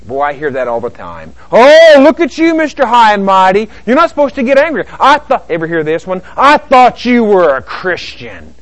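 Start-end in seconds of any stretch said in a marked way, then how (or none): 1.5-5.4 high, loud, hammed-up scolding; mocking reproach. Church critic scolding an angry believer, loudly mocking. 6.4-8.5 loud, drawn-out hammed-up dismay; mock reproach. Critic shaming an angry believer, mock-scandalized.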